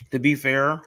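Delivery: talking around a cough drop; words around a lozenge; cough drop in mouth. Real, not acted. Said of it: "I got a cough drop in my mouth".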